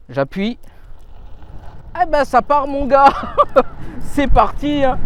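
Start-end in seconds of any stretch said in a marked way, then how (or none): 2.0-3.1 thrilled yell as it takes off; excited shout. Real, not acted. Scooter launches at full power. 3.2-4.0 high burst of thrilled laughter; excited laugh. Real, not acted. Laugh after hard acceleration.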